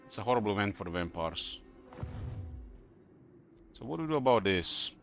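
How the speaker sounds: normal talking voice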